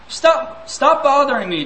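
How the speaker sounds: a fed-up father scolding his son to leave him alone; angry scolding. Retold or imitated. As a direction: shout him off to bed.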